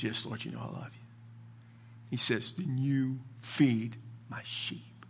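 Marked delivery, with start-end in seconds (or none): 0.0-1.1 very soft, subdued reply; grieved Peter. Retold or imitated. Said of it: "And Peter's grief says". 2.5-5.0 slow, soft, near-whispered command; hushed charge. Retold or imitated. Voices Jesus's final gentle charge.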